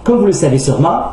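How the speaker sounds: ordinary delivery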